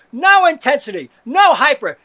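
building to a full shout about staying calm; escalating shout. Acted. Ranting loudly about being low-key.

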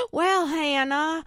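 high, very slow Southern drawl. Pretend. Teasing co-host Hannah on air, mock Southern drawl. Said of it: "she goes in her Southern drawl again".

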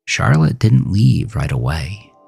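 ordinary delivery

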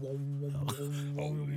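low droning "wom, wom" backing noise; imitated droning wom. Shown as a demonstration. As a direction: hum a long steady "wom" like background music. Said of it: "Do a bit of wom".

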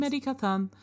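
polite robot. Acted. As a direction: answer like a helpful but clueless robot. Robot repeats name.

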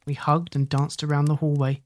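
ordinary delivery, nothing marked

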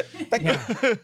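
high, quick, laughing thank you; flicked mock thank you. Co-host imitating the host's thanks, laughing. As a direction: flick it off through a giggle. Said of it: "It would just be a".

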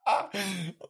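soft laugh trailing down; fading laugh. Real, not acted. Calming down after the TikTok, a soft dying laugh. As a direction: let the laugh die out. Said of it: "I'm crying right now".

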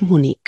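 "Múnich" ending in a hard k. Shown as a demonstration. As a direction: say "Múnich" as if spelt Múnik. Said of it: "normalmente oímos Múnich".

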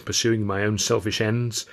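ordinary delivery, nothing marked

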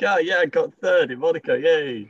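high-pitched, cheery mock celebration; happy man. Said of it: "He's just a happy man".

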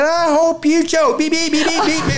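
high mock curse trailing into bleeps; comic high-pitched retold outburst; imitated cussing. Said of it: "She said".

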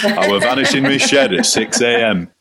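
lightly put-on gruff Yorkshire voice; a hint of a grumbling Yorkshireman; Yorkshire grumble. Mick McCarthy between press questions, mild Yorkshire grumble. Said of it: "the gruff old Yorkshireman".

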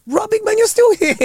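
high teasing greeting; mock greeting. Former pupil greeting old teacher on the picket line, high teasing call. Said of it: "and I was like".

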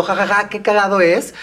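lightly mimicked comedian with a mock laugh; comedian on stage. Guest pictures a comic telling a joke, lightly mimicked comedian. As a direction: lightly act out a comic cracking himself up. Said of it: "En el escenario contando".

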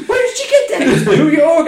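very high, loud laughing voice; squealing laugh. Real, not acted. Joking about the rocket launcher, high squealing. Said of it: "joking, squealing".

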